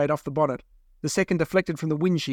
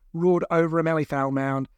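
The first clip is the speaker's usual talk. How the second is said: ordinary delivery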